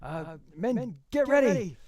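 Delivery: high shouted order; rally the troops, loud and high; Samuel. Acted. Samuel readies his men for a fight, high commanding shout. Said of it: "you can hear, um, Samuel saying".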